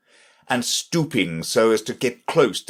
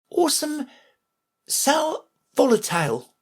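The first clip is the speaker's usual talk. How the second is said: put-on young girl voice. A narrator's reading. Alice voiced in story.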